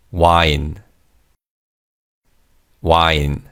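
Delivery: "Wine" said as Korean wa-in; Korean pronunciation. Shown as a demonstration. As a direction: model "Wine" in two Korean syllables for repeating. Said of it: "wine is said phonetically in Korean".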